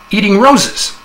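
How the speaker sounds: a hint of a thrilled man; excited husband. A narrator's reading. Husband excitedly reports unicorn.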